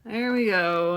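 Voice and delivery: normal talking voice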